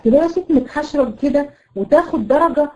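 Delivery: a throaty, overdone ghain; gargled ghain demo. Shown as a demonstration. The teacher shows the student's gargled ghain. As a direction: rasp the ghain, gargling it too heavily. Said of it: "الغين برضه فيه خطأ الغرغرة".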